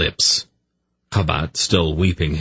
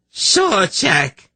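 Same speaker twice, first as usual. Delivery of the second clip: high-pitched cry of loss; anguished shriek; 3 dB louder than usual. A narrator's reading. Kabat seeing her man dead, anguished shriek. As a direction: shriek the name, high and stretched. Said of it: "Kabat suddenly shrieked".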